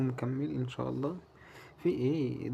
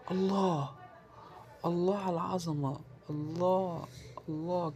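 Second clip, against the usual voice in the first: delighted exclaim; 4 semitones higher than usual. Real, not acted. Thrilled at the slot win that wins back losses, delighted.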